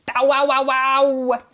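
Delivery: slow, barking dog imitation; playful woof-woofing. A narrator's reading. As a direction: bark like a big dog. Dog chases the cat.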